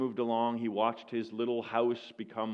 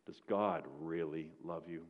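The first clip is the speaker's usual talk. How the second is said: quiet taunting accuser; 6 semitones lower, 9 dB quieter than usual. Retold or imitated. Accuser whispers after the tears.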